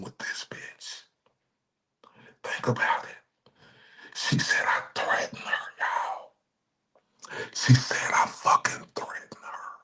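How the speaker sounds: unvoiced whisper with a sob. Real, not acted. Streamer whispering to chat in mock despair, unvoiced. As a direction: breathe it out, near tears.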